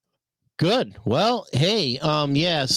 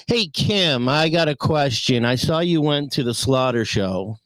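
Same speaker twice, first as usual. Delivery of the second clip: normal talking voice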